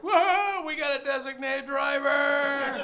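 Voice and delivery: high mock cheer, shouted and drawn out; hammed-up family celebration. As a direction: whoop it up like relieved relatives. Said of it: "They're like".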